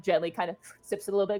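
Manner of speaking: ordinary talk, nothing marked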